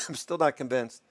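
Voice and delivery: coughing through the words after choking on coffee; coffee down the wrong pipe, coughing. Real, not acted. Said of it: "Coffee went down the wrong way".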